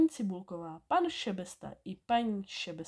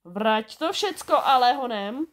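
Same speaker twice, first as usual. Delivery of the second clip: loud, sharp command, louder at the end; barked order; 3 semitones higher, 7 dB louder than usual. A narrator's reading. Šebestová telling off the little thief, barked loudly. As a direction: bark it at the culprit. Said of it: "houkla na něho".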